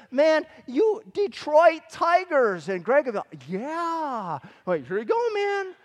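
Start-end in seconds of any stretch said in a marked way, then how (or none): 0.1-2.7 mock sales pitch. Tempting little brother to trade. 3.5-4.4 little brother voice. Retold or imitated. 4.9-5.7 high mock kid voice. Handing cards to brother.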